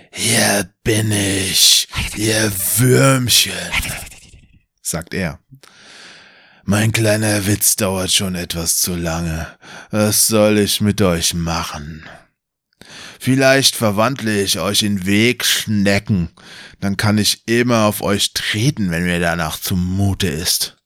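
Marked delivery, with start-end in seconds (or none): 0.1-3.5 villain sneer. Acted. GM voices the villain Kallmann. 6.6-20.8 slow, mocking villain voice, barely changed; lightly voiced mocking wizard. Game master taunts the heroes as a smug wizard, slow and mocking.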